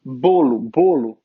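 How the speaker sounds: bolo low and firm, ending in "oo"; word sounded out. Shown as a demonstration. Teacher beginning a transcription, bolo pronounced deliberately. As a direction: say bolo as "BOH-loo", twice, deliberately. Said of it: "Então, aqui".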